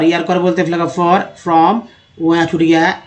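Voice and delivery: ordinary talk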